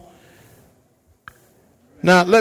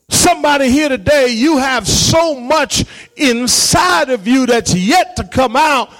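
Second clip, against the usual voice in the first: impassioned preaching; 7 semitones higher than usual. Real, not acted. Pastor urging the congregation to step out on faith, impassioned. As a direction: preach it out with long-held stresses.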